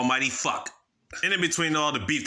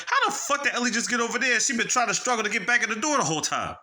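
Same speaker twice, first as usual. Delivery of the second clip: much higher, incredulous outburst; can't believe she got there; stunned disbelief; 10 semitones higher than usual. Retold or imitated. Seeing Ellie in the mirror, shocked high outburst.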